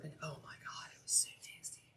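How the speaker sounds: hushed, disgusted whisper. Real, not acted. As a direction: whisper it like a secret gross-out. Disgusted about porta potties.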